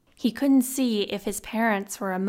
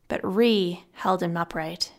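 ordinary delivery

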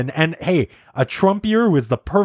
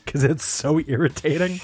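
ordinary delivery

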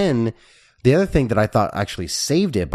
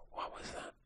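barely audible whisper; puzzled whisper; 26 dB quieter than usual. Acted. Host thinking about a plot point, puzzled whisper. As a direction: whisper it as a private doubt.

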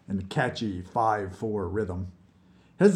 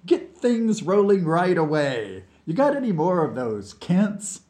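breezy and higher, without a care; airy; 8 semitones higher, 4 dB louder than usual. Acted. Vlad casually asking his anxious colleague for cigarettes, airily. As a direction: toss it off high and lively. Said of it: "said Vlad airily".

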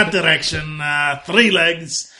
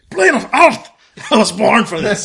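loud, high cry of sudden joy; excited dwarf outburst; 5 semitones higher, 5 dB louder than usual. Acted. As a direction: burst out as a thrilled dwarf, pitched up and loud. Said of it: "the moment the dwarf hears it".

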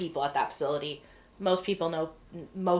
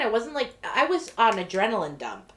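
ordinary talk

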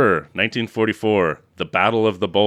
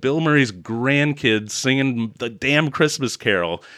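normal talking voice

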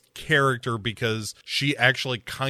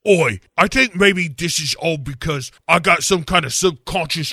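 mock cockney; 5 semitones higher than usual. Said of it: "[name] is like".

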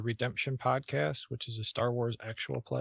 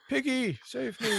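high frog puppet voice calling out; Kermit the Frog; 12 semitones higher, slower than usual. Retold or imitated. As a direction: plead for help like Kermit. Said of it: "your best Kermit the Frog impression".

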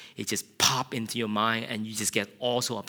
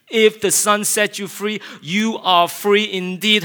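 shouted prayer; 7 semitones higher, 9 dB louder than usual. Retold or imitated. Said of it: "And I began to shout".

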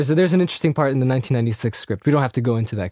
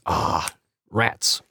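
much lower, softer, grumbling put-on voice; imitating Cypher; 9 semitones lower, 5 dB quieter than usual. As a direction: sink into a deep, sulky villain grumble. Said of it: "Cypher goes".

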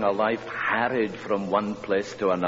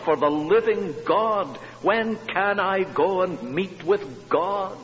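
slow, rising, louder scripture read building in intensity; fervent psalm reading; 5 semitones higher, 4 dB louder, slower than usual. Acted. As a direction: pray the psalm with swelling longing. Reads psalms in preaching voice.

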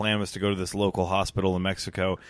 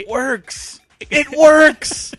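squeaky it works, climbing much higher and ending loud; mock squeal; 11 dB louder, much slower than usual. Teasing his co-host about the accent, a mock falsetto squeal. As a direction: tease him with a rising falsetto squeal.

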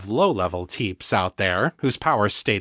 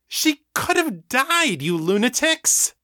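much higher, slow, enraged cry; furious outburst; 13 semitones higher, slower than usual. A narrator's reading. Daniel screams at the hive over Stacy, enraged. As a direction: stretch out the accusation in fury.

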